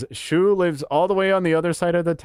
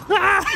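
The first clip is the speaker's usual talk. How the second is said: high laughing yelp; 4 dB louder than usual. Real, not acted. Laughing fit.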